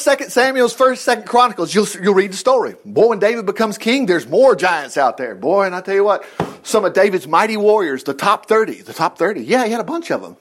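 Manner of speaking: ordinary delivery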